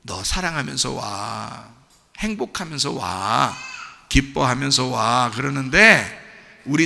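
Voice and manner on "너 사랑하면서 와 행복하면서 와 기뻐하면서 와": low, soft, slow invitation; loving Lord. Acted. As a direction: beckon tenderly, drawing out each phrase. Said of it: "주님은 우리를 끊임없이 사랑하시고".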